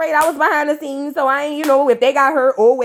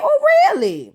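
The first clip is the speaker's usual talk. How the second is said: mock surprise; 12 semitones higher than usual. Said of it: "I said".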